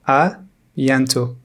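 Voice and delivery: À bientôt slow, with À held apart; slowed-down teacher's À bientôt; slow model. Shown as a demonstration. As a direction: say À bientôt slowly, part by part. Slow model, then natural repeat.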